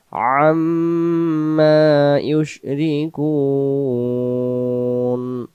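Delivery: slow melodic tajweed chant; Quran recitation. Shown as a demonstration. Teacher chanting the verse for students, slow and melodic. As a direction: draw out each vowel in recitation.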